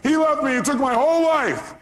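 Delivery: hammed-up pleading caller; caller's voice. Caller pleading with Robbins on radio, high whine. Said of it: "He goes".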